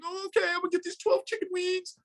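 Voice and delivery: high, silly voice of a meek follower; mock the eager servant; mocking an obedient servant in a high silly voice; high mocking sheep voice. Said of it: "They're like".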